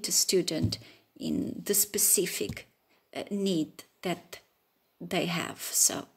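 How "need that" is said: normal talking voice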